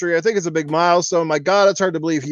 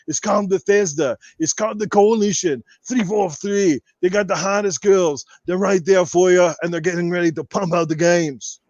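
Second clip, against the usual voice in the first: full podcast rant in a held Arnold voice; Austrian-accented action-star voice, kept up line after line; Arnold Schwarzenegger impression. Podcast host answering viewers in character as Arnold, Austrian action-star voice. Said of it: "enough Arnold questions".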